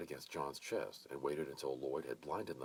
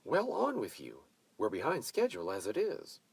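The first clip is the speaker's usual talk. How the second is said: higher, louder, cheerful shooing; good-humored command; 6 semitones higher, 6 dB louder than usual. A narrator's reading. Mavis shooing everyone along, bossy but cheerful. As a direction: chase them off with a cheerful bark. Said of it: "commanded in good humor".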